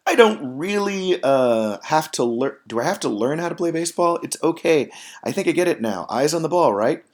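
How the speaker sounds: lightly acted excuse-making kid; kid wriggling out. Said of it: "trying to say the right answer to get out of playing".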